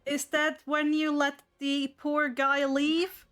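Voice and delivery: ordinary delivery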